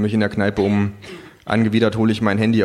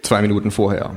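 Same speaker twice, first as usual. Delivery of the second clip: ordinary delivery, nothing marked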